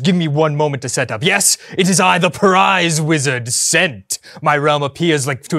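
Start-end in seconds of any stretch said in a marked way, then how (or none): none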